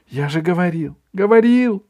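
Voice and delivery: rising excited cry; exclaiming. A narrator's reading. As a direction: blurt it out triumphantly. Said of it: "воскликнул".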